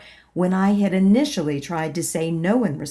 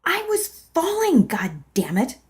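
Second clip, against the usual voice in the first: angry retort; 7 semitones higher than usual. Acted. Arguing with husband.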